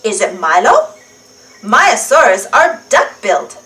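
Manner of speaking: ordinary delivery, nothing marked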